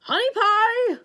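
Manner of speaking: high, very loud bellow; monster bellow. Acted. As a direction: roar the pet name across the cave. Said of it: "the monster bellowed".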